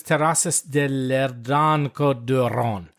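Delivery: slow, butchered French pronunciation; clumsy French accent that drifts toward Italian; terrible French accent. Acted. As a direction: attempt a French accent, badly and slowly. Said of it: "That was the worst French accent".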